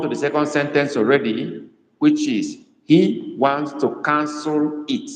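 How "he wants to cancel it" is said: slow dictation. Shown as a demonstration. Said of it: "I ask you to write that".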